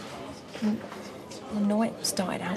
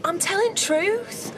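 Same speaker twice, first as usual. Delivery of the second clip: pleading; 10 semitones higher, slower than usual. Acted. Pleading to be believed.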